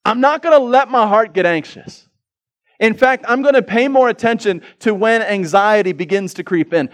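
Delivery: loud, high, driving sermon delivery; preaching at full force. Acted. Pastor urging the church to guard their hearts, preached loud. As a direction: preach it hard to the congregation.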